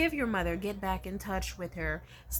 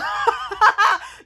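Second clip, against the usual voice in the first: a high, loud burst of laughter; loud shrieking laugh; 13 semitones higher, 12 dB louder than usual. Real, not acted. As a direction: cackle loud at your own joke. Big laugh after own joke.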